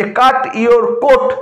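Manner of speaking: each c stretched out slowly; very slow emphatic read. Shown as a demonstration. Teacher drawing students' ears to the c sound. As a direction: spell out the repeated c sound slowly. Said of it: "সি এর রিপিটেশনটা দেখুন".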